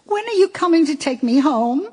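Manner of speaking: put-on high, pleading little boy calling Mommy; a small child calling out for his mother; small child's voice. A narrator's reading. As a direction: reader voicing a young son on the phone, high and plaintive. Homesick child on phone.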